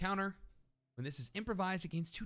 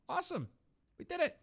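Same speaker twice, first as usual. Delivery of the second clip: squeaky little cheer, far above usual pitch; high-pitched celebration; faster than usual. Real, not acted. Player celebrates getting the graft, high-pitched cheer.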